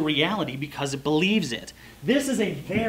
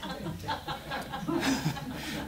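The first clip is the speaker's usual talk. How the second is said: airy laugh; 7 semitones higher, 10 dB quieter than usual. Real, not acted. Laughing at own joke.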